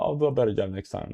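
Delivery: normal talking voice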